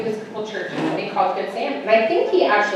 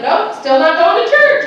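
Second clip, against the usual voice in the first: higher, louder, slow flat-out no; stubborn refusal; 8 semitones higher, 8 dB louder than usual. Retold or imitated. Refusing her friend's church idea, stubborn and loud. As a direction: dig your heels in, slowly. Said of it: "And I'm like".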